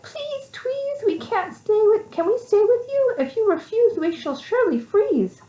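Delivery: high squeaky pleading; pleading mice. Acted. Mice begging Tweez to let them in, high and pleading. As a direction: beg in tiny high voices. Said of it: "all the mice cried".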